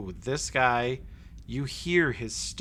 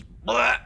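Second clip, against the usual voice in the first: loud high retching blurt; lady throws up before a step; vomiting blurt; 12 semitones higher, 9 dB louder than usual. Acted. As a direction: let out a sudden gagging splat. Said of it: "stands up and just goes".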